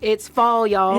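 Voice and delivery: Southern accent demo. Shown as a demonstration. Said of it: "Say it".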